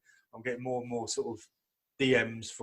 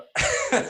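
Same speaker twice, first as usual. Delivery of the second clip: laughing burst; 7 dB louder than usual. Real, not acted. Bursts out laughing at own joke.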